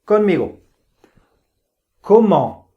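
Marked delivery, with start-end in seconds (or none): 2.0-2.7 "Comment" said clearly and louder, falling; model word to repeat. Shown as a demonstration. Teacher says "Comment" for students to echo. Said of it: "yo les voy a decir y tú vas a repetir".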